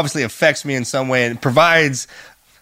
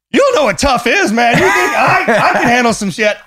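tough boast; 8 semitones higher than usual. Retold or imitated. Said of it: "I'm like".

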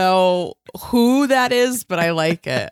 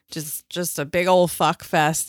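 usual speaking voice

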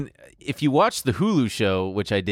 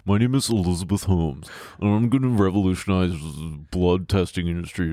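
low put-on voice, lower than usual; Elizabeth Holmes; 4 semitones lower than usual. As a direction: imitate a founder's fake low voice. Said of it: "changed her voice as well and talked like this".

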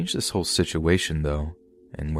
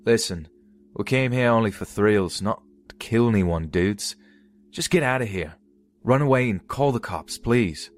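put-on Australian accent; 5 semitones higher than usual. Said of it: "told them in his thick Australian accent".

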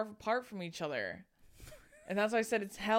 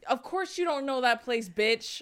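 higher and louder, exasperated and indignant; 5 semitones higher, 5 dB louder than usual. Real, not acted. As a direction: vent at the person who didn't know. Venting at the college questioner.

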